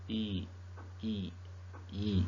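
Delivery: "E" said three times, softly, as a sound demo; soft isolated vowel drill; repeating the sound. Shown as a demonstration. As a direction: drill the single sound quietly. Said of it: "pronounced like".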